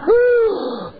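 a sudden high cry of dismay; high startled "ah". Acted. Preacher showing his heart's alarm, high startled cry. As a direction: let out a shocked high gasp.

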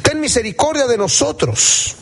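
high-pitched, pleading cry to be heard. Acted. Blind men begging Jesus for mercy, crying out. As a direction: blind men's plea read aloud, high and urgent. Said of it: "clamaron diciendo".

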